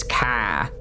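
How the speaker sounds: "car" as an open "kah"; Boston "car" without R. Retold or imitated. Insisting on grandmother's way, "car" pronounced "kah". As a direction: say "car" with its R dropped, Boston style.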